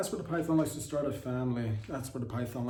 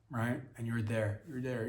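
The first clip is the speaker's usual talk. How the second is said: ordinary talk, nothing marked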